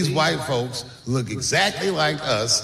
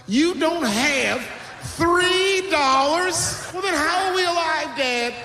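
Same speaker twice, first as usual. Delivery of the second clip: much higher, very slow drawn-out disbelief; a much higher, very slow boy stunned that his dad is broke; incredulous whine; 12 semitones higher, slower than usual. Retold or imitated. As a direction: stretch every word in high disbelief.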